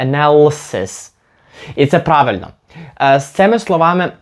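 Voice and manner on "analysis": slow "Analysis" as "a-NAL-sis"; stretched nal, swallowed vowel after it; reduced ANALYSIS. Shown as a demonstration. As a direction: join l straight to s in "Analysis". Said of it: "між L і S ніби нічого немає".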